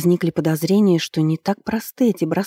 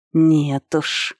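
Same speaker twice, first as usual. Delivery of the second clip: a touch of hissed menace; hissing. A narrator's reading. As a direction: hiss it with restrained anger. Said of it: "зашипел он".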